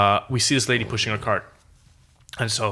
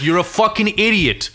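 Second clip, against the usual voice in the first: retold yell; 7 semitones higher, 3 dB louder than usual. Insulting honking man.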